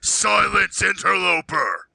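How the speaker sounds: high, commanding bellow; bellowing in Sylvan. Acted. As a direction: boom it out to silence the intruder. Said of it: "Bruce bellows out in Sylvan".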